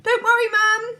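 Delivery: high loud call. A narrator's reading. Laura leaving with the baby, high loud call. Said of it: "she called".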